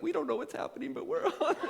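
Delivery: playful imitated bystanders; guys in line. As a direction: chime in like excited bystanders. Two guys in line voiced.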